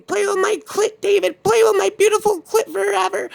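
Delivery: very high gleeful mad creature; Sarah. Retold or imitated. Woman laughing as David runs, high manic glee. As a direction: crow it in an extremely high crazed voice. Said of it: "she was laughing, saying".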